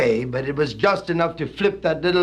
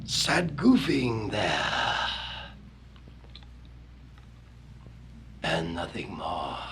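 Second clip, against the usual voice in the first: much lower, softer, slow; low dramatic hush; 9 semitones lower, 9 dB quieter, slower than usual. Acted. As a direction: let it die away ominously. Verse drops low and slow.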